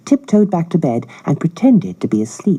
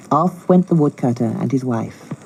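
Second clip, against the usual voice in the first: usual speaking voice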